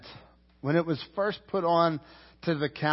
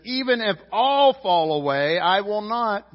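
bold boast; 5 semitones higher, 6 dB louder than usual. Acted. Peter boasting to Jesus.

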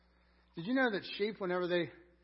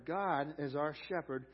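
ordinary talk

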